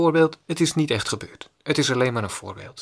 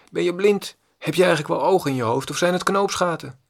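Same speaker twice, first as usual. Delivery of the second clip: mock angry shout; 5 semitones higher than usual. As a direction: bark it like an insulted stranger. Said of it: "op dezelfde manier geschreeuwd".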